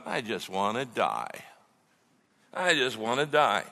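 low, subdued death wish, imitated; mock despair. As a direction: sink into a quiet, dejected mock plea. Imitates despairing Jonah wishing death.

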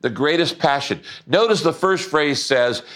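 ordinary talk, nothing marked

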